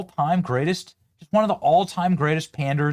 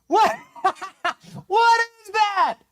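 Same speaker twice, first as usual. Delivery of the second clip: high, laughing what-is-that; laughing disbelief; slower than usual. Real, not acted. Host reacts to the clip, laughing in high disbelief. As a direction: crack up in disbelief.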